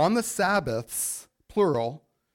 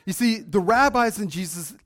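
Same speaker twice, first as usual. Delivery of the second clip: higher, louder preaching at full force; 5 semitones higher, 4 dB louder than usual. Real, not acted. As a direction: build the sermon up, driving the point home.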